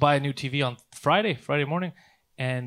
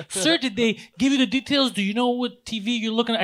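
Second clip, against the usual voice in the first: put-on higher, eager young clerk voice; excited Best Buy kid greeting a customer, high and eager; eager salesman; 5 semitones higher than usual. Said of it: "he's excited".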